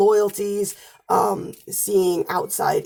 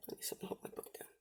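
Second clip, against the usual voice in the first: quick whispered aside; whispering; 26 dB quieter, faster than usual. Real, not acted. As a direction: whisper it fast under your breath. Aside while handling the book.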